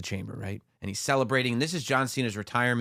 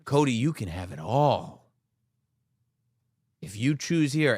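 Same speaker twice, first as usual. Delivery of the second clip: slow, hushed, ominous promo; menacing Rock voice; slower than usual. Retold or imitated. Retelling The Rock's promo to a friend, slow ominous voice. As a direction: hiss the threat slowly. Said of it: "and he goes".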